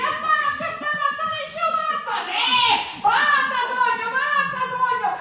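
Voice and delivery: urla acute e forti della folla; grida. Acted. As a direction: gridalo come una folla in chiesa. Crowd shouting she is mad. (gente che urla alla pazza, grido acuto)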